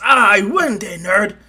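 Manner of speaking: high, very loud victory cry; acted gloating yell; triumphant shout. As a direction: crow over beating your rival. Rival lands and claims victory.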